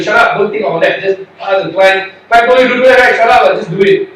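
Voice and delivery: loud, harsh yelling of orders; angry boss shouting. Retold or imitated. Senior lawyers at the firm yelling at a junior, loud and angry.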